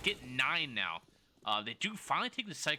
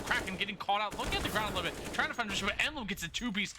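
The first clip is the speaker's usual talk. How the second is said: excited call; 8 semitones higher, faster than usual. Real, not acted. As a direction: lift the call as the round tightens. Clutch round call.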